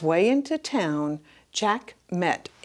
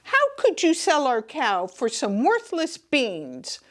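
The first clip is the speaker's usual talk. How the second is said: angry mother; 9 semitones higher than usual. Acted. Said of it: "Jack's mother yelled".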